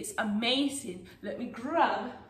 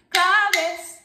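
higher, louder name call; 5 semitones higher, 7 dB louder than usual. Shown as a demonstration. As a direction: call it out for the children to echo. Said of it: "as you call the name claves".

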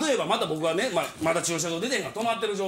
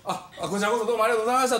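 rushed polite thanks; much faster than usual. Retold or imitated. Retold rushed polite phone thanks.

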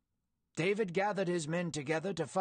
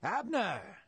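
mild storyteller's call, pitched up then falling; restrained hail from a hilltop; shouted call; 4 semitones higher than usual. A narrator's reading. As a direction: call the name out as if to someone far below. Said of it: "shouted down to Abner".

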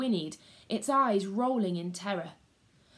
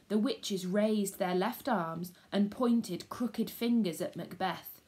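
plain reading voice

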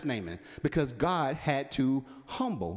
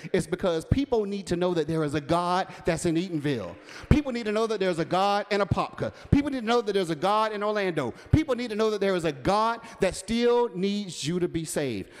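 louder, building preacher cadence; rising preaching; 4 semitones higher, 4 dB louder than usual. Real, not acted. Preacher to congregation, building into a loud refrain. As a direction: hammer each line harder, people need to know.

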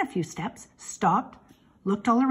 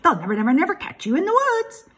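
high, loud goose holler; shouting; 7 semitones higher, 5 dB louder, faster than usual. A narrator's reading. Goose hollers at Wilbur to run downhill, loud. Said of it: "she shouted to Wilbur".

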